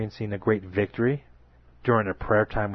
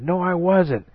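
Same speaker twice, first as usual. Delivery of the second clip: panicked denial; 9 semitones higher, 5 dB louder, slower than usual. Acted. Peter denies knowing Jesus.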